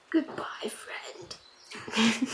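soft whispered farewell, lightly acted crying; tearful goodbye. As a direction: whisper it through pretend tears. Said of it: "cry a little bit and say".

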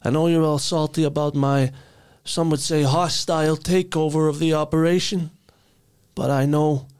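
a pompous crime boss gloating to his crew; Tooth Fairy mob boss. Acted. Tooth Fairy boss addresses his salty gang: high, loud smug swagger.

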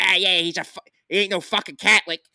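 loud, high mock Philly tough guy; Bill Garnier. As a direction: exaggerate a movie Philly accent. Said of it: "like the Bill Garnier, and he's like".

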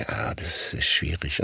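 unvoiced, soft mock hesitation; barely voiced, unsure; murmured reply. Retold own hesitant reply. (leise, zögernd geflüstert)

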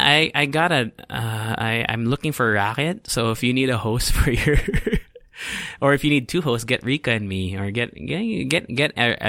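normal talking voice

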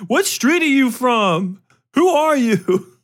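put-on high, loud, tough street-kid voice. Group home kid confronts newcomer, high and loud. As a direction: challenge her like a hardened kid. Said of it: "I'm like".

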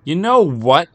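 high, loud angry start to a comeback; angry retort. Acted. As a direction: snap back at his wife, loud and high. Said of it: "he started".

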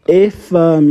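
ordinary delivery

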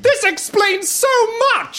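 high, dismayed outcry; agitated, pitch well up; distraught realisation. Acted. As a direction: cry out as it all dawns on you. Distraught realisation about his scar.

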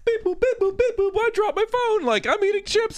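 very high, silly robot-ish voice; squeaky beep boop mockery; goofy phone babble. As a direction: babble nonsense at your phone. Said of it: "I'm on my phone going".

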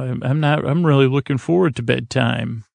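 usual speaking voice

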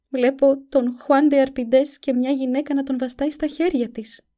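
put-on a frightened young girl seeing visions. A narrator's reading. (φωνή κοριτσιού)

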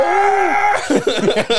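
high screaming laugh; shrieking laugh. Acted. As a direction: let out a loud screaming laugh.

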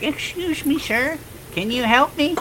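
put-on high, thin old woman asking for help; high little old lady calling to a stranger.

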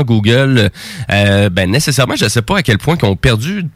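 ordinary talk

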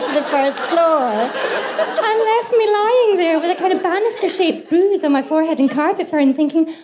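high, laughing, slowed telling of the mishap; laughing disbelief. Retold or imitated. Guest recalling the mattress attack, laughing high. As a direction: laugh through it as the mattress knocks you flat. Climax of her mattress disaster story.

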